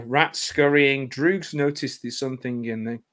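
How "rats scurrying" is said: ordinary delivery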